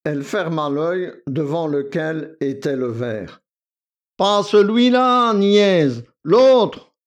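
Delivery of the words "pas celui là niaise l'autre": loud, high-pitched scolding; impatient shout, much higher; exasperated cry. A narrator's reading. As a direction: cry out at a silly pupil. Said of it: "s'écria Victor".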